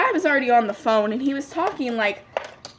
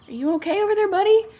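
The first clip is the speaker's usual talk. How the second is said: higher, rising to a high lift on buddy; acted-out check on an odd customer, pitched up; wary check-in; 9 semitones higher, 3 dB louder, faster than usual. Clerk to customer staring at his arm, wary high-pitched check-in.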